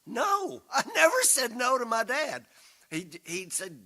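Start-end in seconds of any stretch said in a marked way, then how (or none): 0.1-2.4 raised, high and louder insistence; insisting. Retold or imitated. Father insisting to River that he never refused, raised and high. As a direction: prove the point, voice up. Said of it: "And I said".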